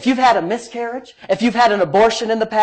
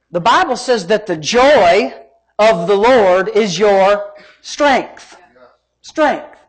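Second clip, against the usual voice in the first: slow preaching emphasis; slower than usual. Real, not acted.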